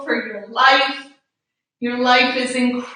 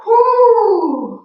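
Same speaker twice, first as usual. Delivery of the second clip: high, loud "whoo" falling away; long falling whoo on the out-breath; whooping exhale; 8 semitones higher, 5 dB louder than usual. Real, not acted. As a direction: blow out a relieved, high whoo. Exhaling after a hard stretch.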